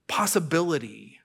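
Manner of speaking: ordinary talk, nothing marked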